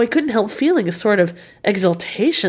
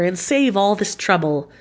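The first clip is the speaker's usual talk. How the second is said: ordinary delivery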